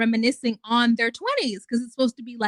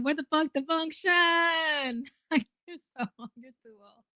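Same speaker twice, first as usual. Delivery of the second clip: laughing; 4 semitones higher, 3 dB quieter than usual. Real, not acted. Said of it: "I'm just laughing at me saying".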